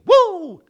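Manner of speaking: high, loud, drawn-out "woo"; whooshing "woo". Acted. Animals flee the roaring lion, whooping away.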